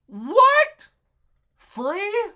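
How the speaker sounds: reliving the shock that the room costs nothing; stunned disbelief. Retold or imitated. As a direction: explode in disbelief. Shock at hearing room is free.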